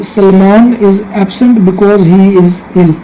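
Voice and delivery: very slow, word by word example sentence; slow dictation reading. Shown as a demonstration. Teacher reads the example sentence to the class, very slow dictation. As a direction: read it out slowly so pupils can copy.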